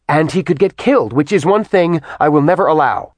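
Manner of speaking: high, heated lecture; agitated scolding. Acted. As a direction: scold her hard and fast.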